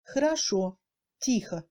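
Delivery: "хорошо, тихо" with unstressed О as mute e; strongly reduced O. Shown as a demonstration. Teacher showing second-degree reduction, "хорошо, тихо" with faint О. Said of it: "ressemble aussi au E muet français".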